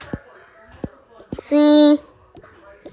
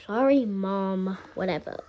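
put-on sulky child; 4 semitones lower, 7 dB quieter than usual. Sulky child answers mom.

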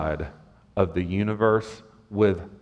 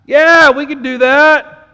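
arrogant boast; 13 semitones higher, 10 dB louder than usual. Retold or imitated. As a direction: brag like overconfident disciples. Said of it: "And in their arrogance".

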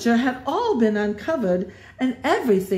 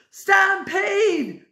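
panicked yell; 11 semitones higher than usual. A narrator's reading. Jasper yells while fleeing the animals. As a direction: scream a warning as you flee. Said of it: "yelled Jasper".

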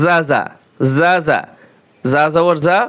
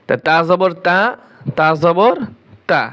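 usual speaking voice

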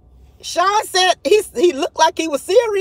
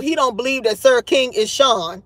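usual speaking voice

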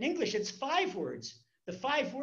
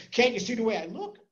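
offended; 3 semitones higher, 7 dB louder than usual. Retold or imitated. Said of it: "He says".

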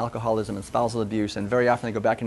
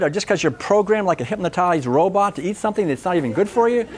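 a hammed-up waiter's tirade at the customer; mock outraged waiter; 7 semitones higher than usual. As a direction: berate the diner like a furious waiter. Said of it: "the wait person doesn't say".

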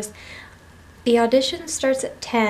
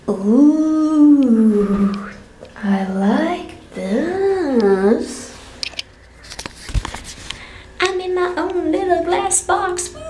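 delighted; 4 semitones higher, 3 dB louder, much slower than usual. Real, not acted. Delighted by shower.

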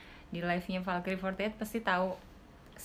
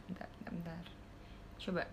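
whisper; 4 semitones lower, 15 dB quieter than usual. Real, not acted. Dropped phone, whispering aside.

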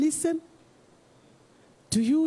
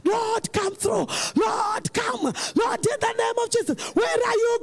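frantic praying shout; 5 semitones higher than usual. Acted. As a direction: plead with rising panic. Praying person crying out louder.

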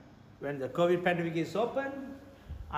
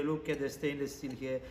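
normal talking voice

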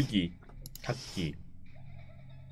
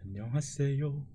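soft, slow hushed greetings; whispering while hiding; 8 dB quieter, slower than usual. Real, not acted. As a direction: tiptoe past, barely breathing the words. Sneaking past the wanderers.

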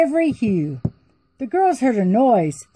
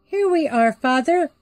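put-on lightly higher girlish voice; sweet young princess answering; 6 semitones higher than usual. Daughters voiced in story.

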